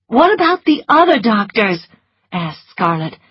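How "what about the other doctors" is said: put-on higher, urgent young woman; Scarlett's voice. A narrator's reading. Scarlett telling Melanie, in her raised voice. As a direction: voice Scarlett with quick worry.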